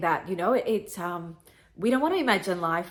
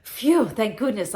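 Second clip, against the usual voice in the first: louder, drawn-out phew of relief; voiced relief; 3 semitones higher, 6 dB louder, slower than usual. Granddaughter voicing grandmother's body letting go, relieved. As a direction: act out a weight coming off, slow and emphatic. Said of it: "your body's way of going".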